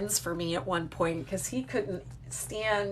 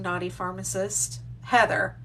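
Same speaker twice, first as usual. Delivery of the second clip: usual speaking voice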